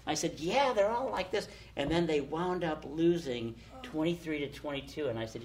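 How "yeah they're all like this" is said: higher-pitched, upbeat retold reply; breezy assurance. Telling Catherine at her first game, breezy assurance. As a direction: assure her brightly that this is normal. Reassuring friend during exciting game.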